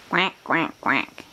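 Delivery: three short quacks, dropping at the end; playful duck noises. Shown as a demonstration. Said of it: "You see the duck?".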